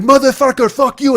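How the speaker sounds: high, very loud screamed insult; furious yell. Retold or imitated. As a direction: scream it at him full force. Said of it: "And he's like".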